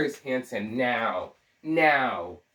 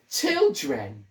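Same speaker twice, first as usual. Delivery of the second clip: a stab at Mid-Atlantic diction; theatrical mock Mid-Atlantic; attempted Mid-Atlantic accent. Shown as a demonstration. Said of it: "do a Mid-Atlantic accent".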